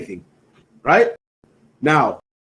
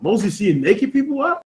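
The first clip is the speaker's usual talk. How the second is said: slow, higher-pitched incredulous question; mock disbelief; 6 semitones higher, slower than usual. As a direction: question it as if mildly scandalized. Feigns shock at the verse.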